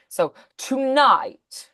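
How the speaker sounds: slow, drawn-out "tonight" with long vowels; stretched vowels. Shown as a demonstration. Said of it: "think about elongating the vowels".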